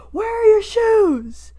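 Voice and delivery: high, slow, loud mock yell; imitated shout of outrage; retold argumentative shout. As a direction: holler it like an appalled stranger. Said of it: "got in, like, a mini argument".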